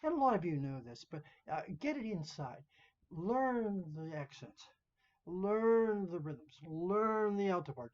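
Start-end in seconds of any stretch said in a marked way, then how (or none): 3.1-7.9 higher and louder, each "Learn" punched, very slow; slow insistent emphasis. Shown as a demonstration. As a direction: drive every "Learn" home like a drill. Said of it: "See that?".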